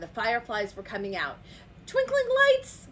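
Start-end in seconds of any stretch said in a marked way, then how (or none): 1.9-2.9 high, loud, amazed cry; gasped excitement. A narrator's reading. Toucan spots fireflies at night, gasping in excitement. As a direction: exclaim in awe. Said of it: "gasped Toucan".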